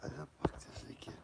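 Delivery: whispered under the breath in annoyance; frustrated whisper. Real, not acted. Vlogger annoyed at his camera, whispering.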